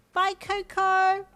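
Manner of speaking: put-on high, loud, drawn-out kid voice; small child calling. A narrator's reading. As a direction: call goodbye like a little playmate. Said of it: "a fellow small voice called out".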